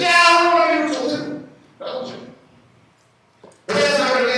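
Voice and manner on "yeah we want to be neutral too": mock Belgian government. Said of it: "We said".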